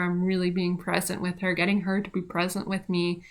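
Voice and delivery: normal talking voice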